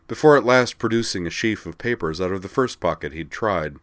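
plain reading voice

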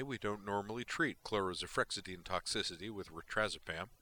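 usual speaking voice

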